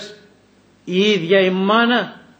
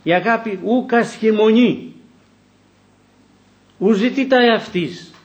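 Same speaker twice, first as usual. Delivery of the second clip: higher, slow liturgical intoning; raised, slow recitation of the hymn of love; solemn scripture recital; 6 semitones higher than usual. Acted. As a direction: intone Paul's words like a reading in church. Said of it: "ανέφερα στον ύμνο της αγάπης".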